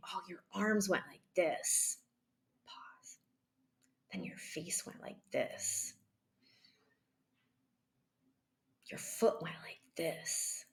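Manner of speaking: hushed, nearly whispered, with long pauses; slow and calm. Shown as a demonstration. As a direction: describe gently, breathing between each observation. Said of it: "keep going slowly and breathe and be calm".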